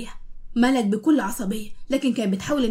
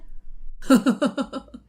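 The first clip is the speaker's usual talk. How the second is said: mocking laugh. Youssef mocks Malak's plea.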